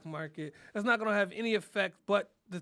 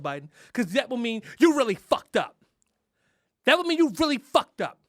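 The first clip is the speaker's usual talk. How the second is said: louder, higher scold; mock scolding; 5 semitones higher, 7 dB louder than usual. Comic scolding Biden, loud mock outrage. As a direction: drive it home to Biden.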